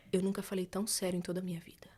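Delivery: soft unvoiced whisper, dead serious; whispered earnest vow. Boyfriend swears he is serious, whispered. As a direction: whisper it, solemn.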